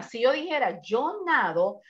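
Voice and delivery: ordinary talk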